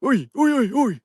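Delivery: high, loud mock yell; retold shout. Ghost hunter imitating someone yelling at the group, high and loud. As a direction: bark it out like the stranger did. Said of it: "Everyone else heard someone go".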